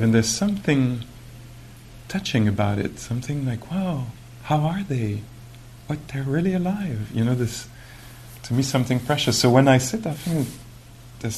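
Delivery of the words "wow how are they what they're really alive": higher, gently astonished; acted wonder. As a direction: voice a tender marvelling at small creatures. Acts wonder at living creatures.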